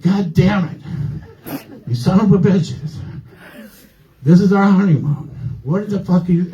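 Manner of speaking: imitating an angry outburst, pitched high; pissed-off wife. Wife cursing at the front desk, pissed off. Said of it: "My wife was so pissed".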